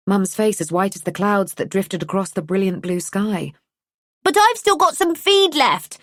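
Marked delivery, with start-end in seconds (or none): 4.2-6.0 put-on high, pleading little boy voice; a small boy begging to stay a bit longer; child protesting. A narrator's reading. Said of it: "Rue protested".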